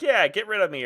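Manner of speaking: breezy quitting player. Retold or imitated. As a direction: shrug it off cheerfully. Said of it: "instead of just being like".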